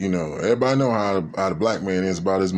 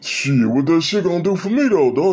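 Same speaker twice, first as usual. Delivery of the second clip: dismissive friend; 8 semitones higher, 3 dB louder than usual. Retold or imitated. Friend brushing off request.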